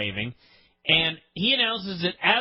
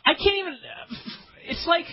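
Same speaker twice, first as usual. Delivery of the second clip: exasperated; 13 semitones higher than usual. Real, not acted. Host fed up with a bad wrestling show, exasperated outburst. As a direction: throw up your hands in disbelief.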